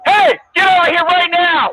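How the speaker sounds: loud commanding yell; angry shout. Real, not acted. Officer yells at drunk man to come back. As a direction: force him back over.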